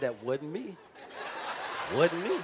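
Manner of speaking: laughing through a sheepish denial; a half-laughed wasn't me; laughing mock denial. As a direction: play it guilty and giggling. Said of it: "'cause you said".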